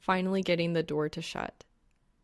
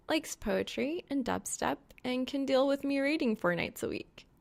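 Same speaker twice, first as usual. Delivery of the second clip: lightly voiced young woman, higher pitch; a teasing girl shrugging it off, pitched up; Reaper's voice; 7 semitones higher than usual. A narrator's reading.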